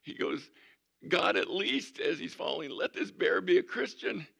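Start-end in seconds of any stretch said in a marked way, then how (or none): 1.1-2.0 mock frantic plea. Hunter falling, last plea. 2.7-4.2 mock frantic plea. Hunter falling, last plea.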